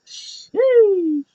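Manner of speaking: a high, drawn-out sheesh. Real, not acted. Reacting to a savage burn in the ring, impressed. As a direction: stretch it up high like you're impressed.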